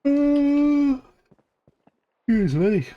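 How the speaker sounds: talking through a yawn. Real, not acted. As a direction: yawn while speaking. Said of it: "Yawning again".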